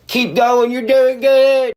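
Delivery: high, loud put-on cheering; drawn-out, squealed mock cheer; mock encouragement. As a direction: cheer them on in a silly high voice. Mock cheering on his critics.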